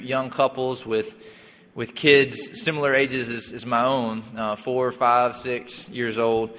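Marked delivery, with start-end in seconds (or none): none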